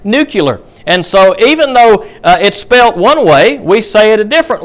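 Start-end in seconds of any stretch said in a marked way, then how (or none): 0.0-0.6 nuclear pronounced NOO-kyuh-ler; nuclear said as nucular. Shown as a demonstration. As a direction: say nuclear the common nonstandard way, kyu-ler. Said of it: "so many people say the word".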